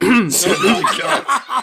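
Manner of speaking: stretched out laughing oh my God; laughing disbelief. Real, not acted. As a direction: laugh through the words. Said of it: "reaction to coughing fit".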